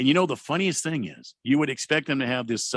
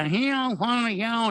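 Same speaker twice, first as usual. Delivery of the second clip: high, mock Cajun twang; Cajun accent; 8 semitones higher, much slower than usual. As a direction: imitate the Cajun accent, pitched way up. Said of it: "the Cajuns, I mean, that's, you, you get the".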